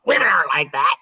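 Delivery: imitating a sassy mother, a bit punchy. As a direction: push back with mock sass. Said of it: "She'd get all sassy and poosty".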